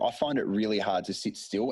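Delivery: ordinary delivery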